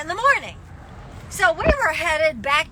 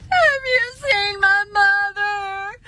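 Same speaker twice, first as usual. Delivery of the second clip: frantic crying; 4 semitones higher, 3 dB louder, slower than usual. Retold or imitated. Running into stores searching for mom.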